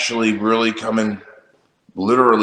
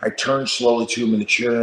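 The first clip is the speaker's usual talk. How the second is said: ordinary talk, nothing marked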